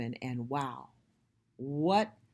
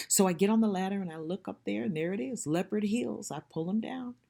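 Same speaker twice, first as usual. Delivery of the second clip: ordinary talk, nothing marked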